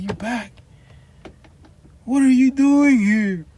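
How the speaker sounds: high, loud, slow squeal of surprise; shocked lady. Retold or imitated. Imitating the clerk shocked to see him back, high and loud. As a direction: mimic the lady's shrill disbelief.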